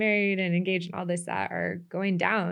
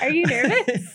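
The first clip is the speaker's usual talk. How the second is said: nervous laughter; 6 semitones higher, 7 dB louder than usual. Real, not acted. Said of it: "A little bit".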